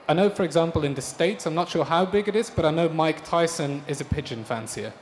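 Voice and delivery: ordinary talk, nothing marked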